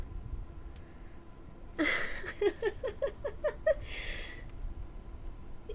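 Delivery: high, long mocking cackle; gleeful villain laugh. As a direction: cackle at your victim's fright. Villain laughs at frightened listener.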